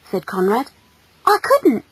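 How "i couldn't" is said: put-on high, young protest; flustered boy. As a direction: deny it in a small high voice. Flustered boy denies the accusation.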